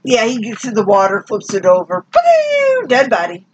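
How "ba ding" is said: high, mock "ba-ding" noise; imitated sound effect. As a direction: pitch it way up like a cartoon sound effect. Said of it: "it did not make that sound".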